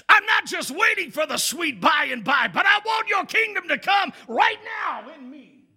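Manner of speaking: high, loud, shouted sermon climax; impassioned preaching. Acted. Pastor urges the church to pray for God's kingdom now, shouting. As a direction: preach it with fire, calling amen.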